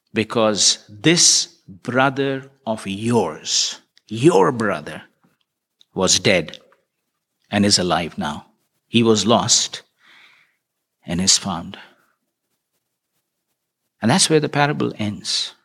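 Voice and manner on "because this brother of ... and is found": slow, low, gentle father pleading with his son; father's gentle words. Acted. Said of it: "the father says this".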